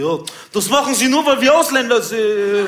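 high, loud mock protest; put-on wannabe-gangster indignation; aggrieved gangster customer. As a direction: whine it out loudly, dragging the end. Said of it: "Da meinte er".

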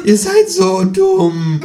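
very high, loud, drawn-out croak; high kangaroo screech, stretched out. A narrator's reading. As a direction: squawk it slowly at the fools. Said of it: "krächzt das Känguru".

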